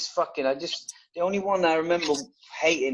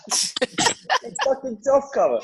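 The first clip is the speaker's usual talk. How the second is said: mock sergeant bark; 11 semitones higher than usual. Drill sergeant barking at recruit.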